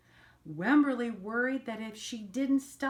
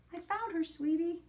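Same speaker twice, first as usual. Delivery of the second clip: higher, gentle mother reassuring; mother's gentle voice; 7 semitones higher than usual. Acted. Mother reassuring Wemberly she found Petal, gentle. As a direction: soothe her like a caring mom. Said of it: "And her mother says".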